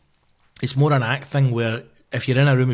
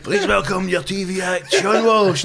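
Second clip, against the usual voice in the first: sung, higher announcer voice; hammed-up MC intro; 6 semitones higher, slower than usual. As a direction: belt it like a cheesy host. Mocking MC's big TV introduction.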